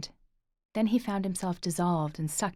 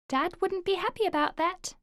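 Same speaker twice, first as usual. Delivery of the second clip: put-on higher boy's voice; child voice; 12 semitones higher, 3 dB louder than usual. Boy warning his uncle, higher child voice. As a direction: speak as a small boy.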